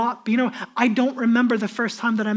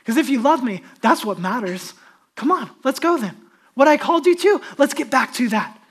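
usual speaking voice

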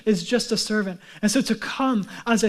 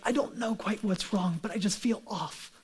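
subdued unease; 9 dB quieter than usual. Acted. Someone on an off day admits it to themselves, quiet and uneasy. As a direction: voice a vague unease softly, as if to yourself. Voicing listener's vague unease.

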